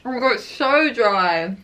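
talking between bites; chewing while talking. Real, not acted. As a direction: mouth partly full of donut. Seen 2: Woman bit donut at -4, mouth working, donut in hand.

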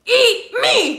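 loud, high turkey-costume yell; Pugsley as a turkey. Retold or imitated. Retelling Pugsley's Thanksgiving play to her friend, a loud high yell. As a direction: belt it out like a kid in a turkey suit. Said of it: "he goes".

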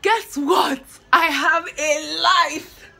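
excited outburst. Real, not acted. Vlogger announcing to viewers she has a life, excited and slow. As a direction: burst out with it. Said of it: "why am I telling you this".

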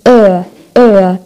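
أ drawn out as "er", like disgust; long schwa. Shown as a demonstration. Teacher likening schwa to disgust, أ stretched to "er". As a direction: hold أ as a long "errr". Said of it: "مثل صوت زي حدا متقزز من شي".

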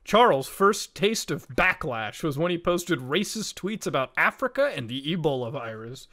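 ordinary delivery

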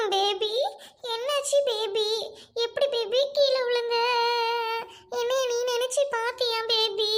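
slow, sweet cooing to the husband; cooing baby talk. Acted. Wife cooing pet names at injured husband. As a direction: coo over him like a lover. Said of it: "baby கூபின்னு சொல்லிட்டு இருக்கியே".

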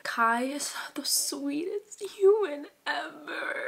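high, slow, airy swoon; gushing. Real, not acted. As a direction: melt into a drawn-out high coo. Gushing over a favorite character.

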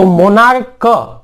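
मोनार्क, then its क sounded separately; final K isolated. Shown as a demonstration. Teacher stressing the ending of मोनार्क, a separate "k". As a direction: repeat the hard "k" after मोनार्क. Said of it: "क है".

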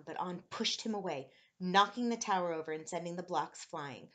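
plain reading voice

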